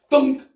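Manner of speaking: punchy loud thunk sound. Acted. Storyteller to kids, a loud thunk effect. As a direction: smack the thunk like a ball hitting.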